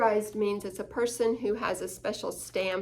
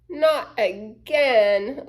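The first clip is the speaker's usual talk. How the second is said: slow, louder annoyed drawl; complaining; 6 dB louder, much slower than usual. Acted. Lucy complains over her brother's shoulder, annoyed. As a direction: groan it out, fed up. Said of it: "Lucy complained".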